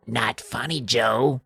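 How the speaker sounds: lightly voiced sinister little gnome; gruff gnome. A narrator's reading. Gnome Hap warning Joe, hushed gruff little voice. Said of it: "hears their tiny little gruff voices".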